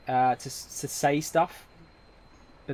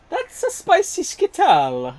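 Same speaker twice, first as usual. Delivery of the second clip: much higher, louder and slow, a cartoonish shriek of a line; hammed-up spicy reaction; 8 dB louder, slower than usual. Streamer reacting to a hot Skittle, hammed-up high squeal. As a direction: overplay the burn like a cartoon.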